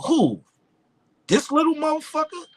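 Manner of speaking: imitating the boss's disbelief; mock disbelief. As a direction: act shocked at how young he is. Boss disbelieving the young rapper.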